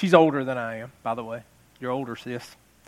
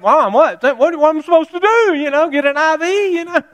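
higher, louder whiny protest; exasperated kid; 11 semitones higher, 5 dB louder than usual. Retold or imitated. Son protesting to mom at the supper table, high and whiny. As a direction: kid arguing back in disbelief. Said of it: "I'm like".

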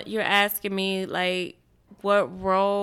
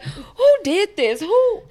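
mock indignant son; 9 semitones higher, 5 dB louder than usual. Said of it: "he think he the smartest person".

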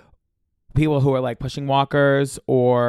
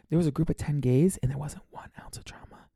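breathy whispered aside, like spilling a secret; stage whisper; 2 semitones higher, 6 dB quieter than usual. Acted. Whispering to listeners that the trip had no drama. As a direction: lean in and whisper it.

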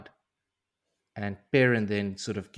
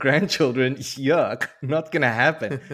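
put-on louder, higher, grossed-out kid voice; disgusted kid; 5 semitones higher, 7 dB louder than usual. Young cousin rejects grandchildren, loud mock kid disgust. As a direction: sneer it like a boy who hates girls.

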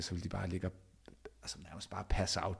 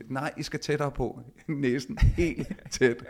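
playful coaxing; 6 semitones higher, 11 dB louder, much slower than usual. Retold or imitated. Coaxing kids closer, playful.

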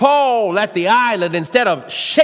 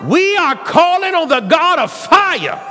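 roaring rallying speech; 8 semitones higher than usual. Acted. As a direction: rebuke the spirits at full force. Shouting prayer of rebuke.

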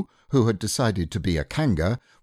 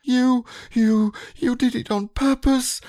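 very high, louder sputtering squeak; spluttering; 5 dB louder than usual. Acted. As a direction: sputter through the soap. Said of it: "spluttered Piglet".

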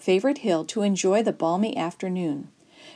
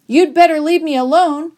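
high, loud stalling threat; raised voice, bluffing for time; called-out warning; 8 semitones higher, 9 dB louder than usual. A narrator's reading. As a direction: call out a warning to bullies. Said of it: "I called".

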